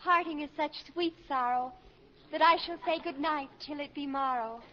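softly declaimed Juliet lines, a touch theatrical; Shakespeare recitation. Pretend. Daughter rehearsing Shakespeare for her admiring teacher, softly declaimed. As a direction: recite it like a schoolgirl playing Juliet. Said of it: "Oh, that, that's very good, Susan".

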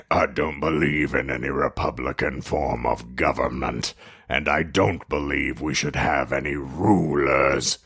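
low voice. Acted. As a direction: read it low and hushed. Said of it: "that's not the high-pitched guy voice?".

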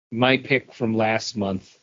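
normal talking voice

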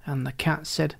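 ordinary delivery, nothing marked

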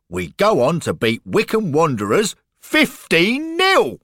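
high, loud, triumphant tour guide; proud announcement. Acted. Guide telling Lloyd of the big win, triumphant. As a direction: build to a triumphant scoreline.

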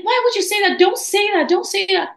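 high-pitched, dismayed protest; alarmed manager. Retold or imitated. Manager alarmed, begs her to stop.

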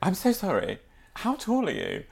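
high, soft apologetic inquiry; lightly put-on, high-pitched politeness; polite stranger. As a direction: imitate a woman politely accosting a stranger. Voices a woman approaching Dad.

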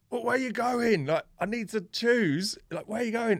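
high, loud alarmed cry at a stranger in the way; panicked high yelp. Retold or imitated. Skater yelling at a man blocking the hill, panicked and high.

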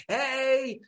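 high, drawn-out whooping greeting; celebratory shout. Retold or imitated. Character barging in with champagne, high celebratory call. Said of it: "just barges round ... with champagne going".

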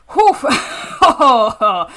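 loud laughing exhale. Real, not acted. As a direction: laugh it off, overwhelmed. Overcome, laughs.

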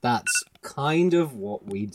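slow, soft deadpan repeat; deadpan mocking echo. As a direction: echo the partner's flat ending to tease. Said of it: "I was drowning".

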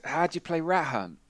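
lightly voiced eager child asking about "rat hunt"; curious kid. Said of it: "He just goes".

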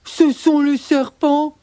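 slow, high, gruff accusation; growling bears. Acted. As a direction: growl the blame slowly as a bear. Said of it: "grognent les ours".

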